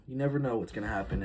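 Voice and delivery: usual speaking voice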